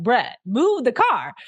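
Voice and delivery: mildly exasperated "Bruh, move the car", slow and pushed; irritated. Retold or imitated. Narrator retelling repeat ask to Mike, mild irritation. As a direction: let the patience wear thin. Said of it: "And now I'm getting irritated".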